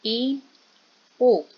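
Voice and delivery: I and U each sounded alone and held; vowel demonstration. Shown as a demonstration. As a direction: model I, then U, with a pause between. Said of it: "pronunciémoslas por favor". (vocales cerradas I, U, sostenidas por separado)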